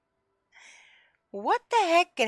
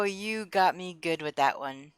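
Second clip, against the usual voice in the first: normal talking voice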